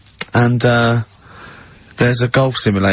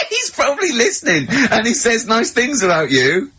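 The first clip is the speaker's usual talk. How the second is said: laughing; 11 semitones higher than usual. Real, not acted. Host cracks up at Carl.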